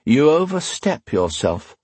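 calm rebuke. A narrator's reading. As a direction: rebuke without raising the voice. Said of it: "Phelan Parr said calmly".

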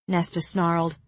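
ordinary delivery, nothing marked

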